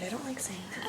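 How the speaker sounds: whispered aside. Real, not acted. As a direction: murmur it under the breath. Embarrassed aside.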